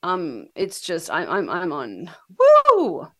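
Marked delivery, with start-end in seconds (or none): 2.4-3.1 excited whoop. Real, not acted. High on painkillers, cheering.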